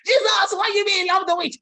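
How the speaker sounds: high, loud disbelieving cry; shocked protest. Acted. A doubter cries out to Jesus in shock.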